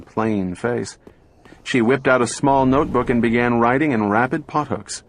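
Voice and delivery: usual speaking voice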